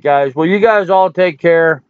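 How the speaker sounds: usual speaking voice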